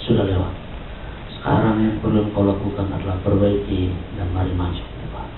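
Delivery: very soft, low reassurance; retold hushed comforting words; gentle forgiveness. Mentor forgiving a repentant mentee, hushed and reassuring. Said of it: "Saya bilang".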